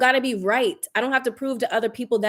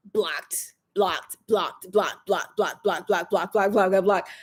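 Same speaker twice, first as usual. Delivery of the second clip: rapid repeated blocking; 3 semitones lower, slower than usual. Acted. Blocking online commenters one after another, low and rhythmic. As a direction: tick them off one by one. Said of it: "repeated dismissal".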